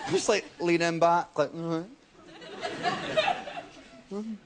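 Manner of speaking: hammed-up laid-back cool, slow mm-hmms; mock cool. Comic acting cool trapped in a train toilet, mock slow and casual. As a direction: lean back and play it cool, mockingly. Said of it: "Play it cool".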